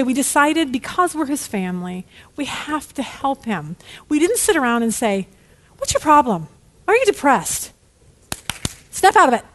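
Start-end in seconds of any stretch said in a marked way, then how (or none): 5.8-9.4 higher, impatient telling-off, building louder; put-on exasperation; mock scolding. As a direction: scold as if they should just get over it. Mock scolding of depressed son.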